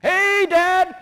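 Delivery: high, loud, oblivious greeting; acted cheery kid shout. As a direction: call back happily as a little boy. Son happily calling back from field.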